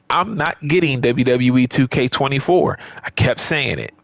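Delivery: usual speaking voice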